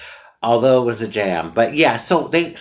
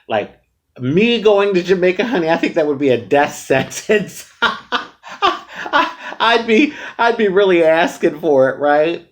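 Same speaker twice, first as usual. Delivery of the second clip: laughing; 7 semitones higher, 4 dB louder than usual. Real, not acted. Laughing about dangerous trip.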